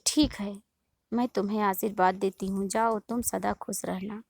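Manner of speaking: usual speaking voice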